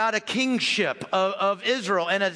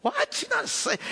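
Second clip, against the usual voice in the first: much higher, incredulous mock scoff; scoffing disbelief. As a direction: toss it off like a crowd laughing him down. Mourners scoff at Jesus.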